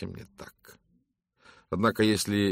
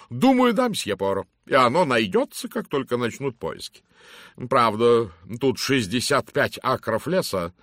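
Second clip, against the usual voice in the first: loud, hearty lady's greeting; booming matron; 7 semitones higher, 7 dB louder than usual. Acted. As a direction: hail him like a bossy matron. Mrs Masterton insists. (громкий зычный голос дамы)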